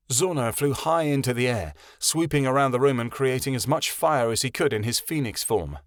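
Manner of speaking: ordinary delivery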